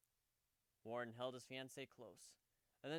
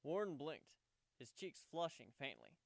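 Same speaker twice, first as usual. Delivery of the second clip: ordinary delivery, nothing marked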